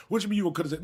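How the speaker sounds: ordinary talk, nothing marked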